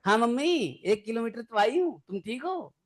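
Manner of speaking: lightly voiced cheerful daughter checking on mom; a hint of a carefree bride on the phone; chirpy daughter on phone. Daughter phones mom after leaving.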